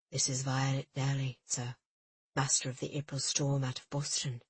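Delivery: put-on low, smooth English accent; smooth English accent introducing his captain; pronounced English accent. A narrator's reading. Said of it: "his English accent particularly pronounced".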